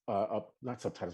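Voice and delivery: ordinary talk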